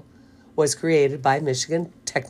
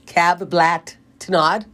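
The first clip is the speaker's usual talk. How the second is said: backwards speech; slower than usual. Shown as a demonstration. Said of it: "say it again".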